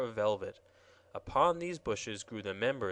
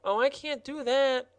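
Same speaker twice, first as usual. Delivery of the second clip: much higher, louder protest; flustered high gardener; objecting gardener; 13 semitones higher, 5 dB louder than usual. Acted. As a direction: refuse in a fluster. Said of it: "objected the gardener".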